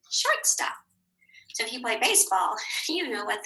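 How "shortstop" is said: Shortstop. said again much higher; very high echo of Shortstop; echoed word. Shown as a demonstration. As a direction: squeak Shortstop. up high on the repeat.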